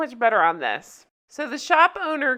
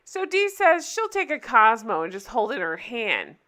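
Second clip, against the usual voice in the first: normal talking voice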